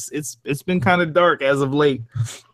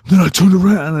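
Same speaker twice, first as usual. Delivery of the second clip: mock intense, higher and louder; 4 semitones higher, 7 dB louder than usual. Podcaster imitating a fellow narrator's intense story read, mock intense. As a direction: push it out with exaggerated intensity. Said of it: "Vidit was all intense".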